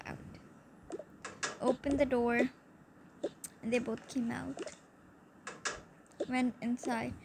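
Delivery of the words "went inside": usual speaking voice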